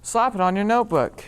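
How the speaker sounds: loud, punchy sales line. Acted. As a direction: belt it like a pitchman. Commercial line before whisper.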